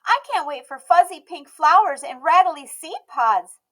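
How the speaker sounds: put-on high, bright little girl voice, loud and proud; a stubborn little girl declaring herself; Emmy's child voice. A narrator's reading. Emmy eager.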